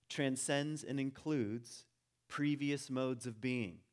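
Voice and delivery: slow, deliberate statement of the key idea; slow emphasis. Shown as a demonstration. Teacher spelling out the core principle slowly. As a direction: lay each word down slowly. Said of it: "All right?".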